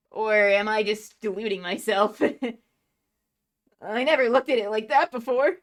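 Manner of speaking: lively anime character voices; voicing manga characters. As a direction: act out each pirate role. Reads manga aloud voicing characters.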